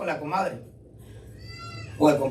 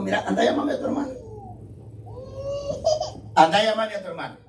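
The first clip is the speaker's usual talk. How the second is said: exasperated Jesus; 9 semitones higher, faster than usual. Retold or imitated. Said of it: "Cristo se rascó la cabeza".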